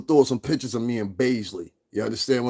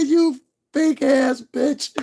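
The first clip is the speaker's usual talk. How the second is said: retold insult; 13 semitones higher, 4 dB louder, much slower than usual. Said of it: "I said".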